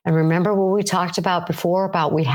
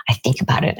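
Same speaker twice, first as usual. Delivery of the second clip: lower, murmured under the breath as if stewing; brooding whisper; 4 semitones lower, faster than usual. Acted. Stewing alone after a fight with the spouse, whispered. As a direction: whisper it low, like an obsessive thought. Ruminating over argument with spouse.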